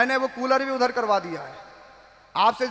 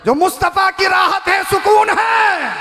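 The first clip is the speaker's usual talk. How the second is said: preaching at full force; 6 semitones higher, 6 dB louder than usual. Acted. Preacher extolling Fatima to listeners, full-force preaching. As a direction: ring it out over the gathering.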